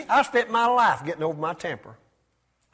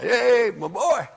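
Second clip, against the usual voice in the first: fond father; 4 semitones higher, much slower than usual. Pretend. Voicing God applauding.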